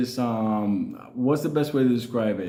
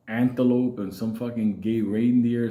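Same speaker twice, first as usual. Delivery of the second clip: usual speaking voice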